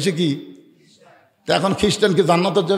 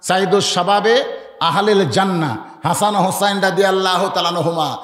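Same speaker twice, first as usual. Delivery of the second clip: ordinary talk, nothing marked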